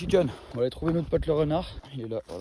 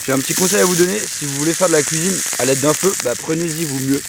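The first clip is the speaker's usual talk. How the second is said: TV chef voice; 2 semitones higher, 8 dB louder than usual. Pretend. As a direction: address viewers like a TV chef. Cooking-show host tips to viewers.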